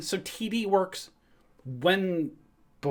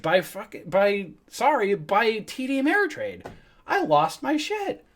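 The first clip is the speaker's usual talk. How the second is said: exasperated outburst; 6 semitones higher than usual. Real, not acted. Furious at TD managing IRA.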